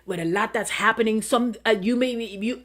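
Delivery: normal talking voice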